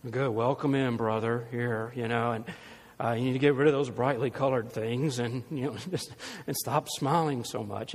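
mock stern church elder.

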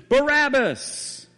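high, stretched-out shout of the name; crowd shout heard from afar. Retold or imitated. What Barabbas hears from his cell, a long high shout. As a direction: cry it out like a mob through prison walls. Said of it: "a crowd shout in unison".

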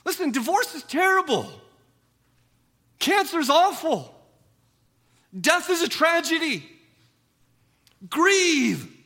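high, loud emphatic sermon; preaching at full force. Acted. Preacher proclaiming that Christians grieve, high and loud. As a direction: declare it boldly to the congregation.

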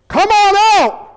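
high, hollered summons; acted shout to a distant crew; calling out. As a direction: call across the water to another boat. Boss calls another ship.

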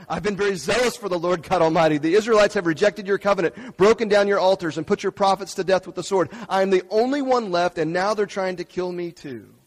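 loud, pressing complaint, pushed hard; loud impassioned reading. Acted. Elijah pours out his complaint to God, loud and impassioned. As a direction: throw the complaint out full force.

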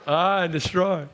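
ordinary talk, nothing marked

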